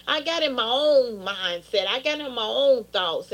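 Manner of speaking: usual speaking voice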